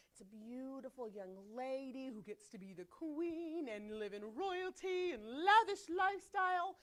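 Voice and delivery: mock-swooning fairy-tale voice; dreamy princess gushing. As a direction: gush dreamily, stretching out the lavish words. Gushing over girls' fairy-tale side of Esther.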